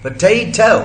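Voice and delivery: पोटैटो as p'TAY-toh, P glued to T; पोटैटो with a puffed P and no schwa; aspirated, vowel elided. Shown as a demonstration. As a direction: drop the first vowel of पोटैटो, punching TAY. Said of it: "यहां पर gap ही नहीं मिला अ को".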